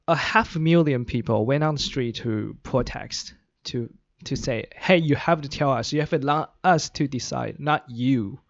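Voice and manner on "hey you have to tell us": ordinary delivery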